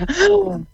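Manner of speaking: a sharp, high in-breath before laughing; showing the deep breath in; demo breath in. Shown as a demonstration. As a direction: gasp in as if about to laugh. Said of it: "Take a deep breath in".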